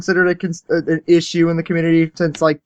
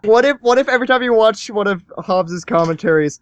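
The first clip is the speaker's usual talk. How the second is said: normal talking voice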